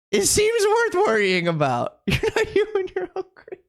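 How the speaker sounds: high giggly voice through laughter; giggling high; laughing. Real, not acted.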